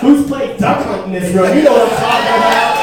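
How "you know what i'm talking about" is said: loud crowd call. Real, not acted. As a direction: shout it out, rising.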